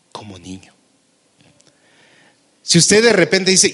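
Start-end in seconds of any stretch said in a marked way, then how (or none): 0.1-0.7 fading to a whisper on como niño; hushed whisper. Real, not acted. Preacher confides to the congregation, softer then whispered. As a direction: let the voice sink into a whisper.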